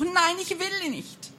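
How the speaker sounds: high, slow, pleading cry; desperate refusal. A narrator's reading. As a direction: push the witch away with your voice. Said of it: "brüllte Lene".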